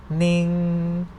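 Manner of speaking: "ning" held level, drawn out; mid tone. Shown as a demonstration. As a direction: sustain each "ning" on one even pitch. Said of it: "no tone mark represent the mid tone".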